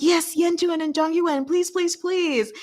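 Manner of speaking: high, eager begging; pleading fangirl squeal. Retold or imitated. Fan pleading for her ship, high and eager. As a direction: beg the two characters to get together. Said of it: "I'm like".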